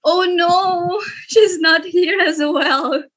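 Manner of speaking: laughing dismay. Real, not acted. Winner absent again.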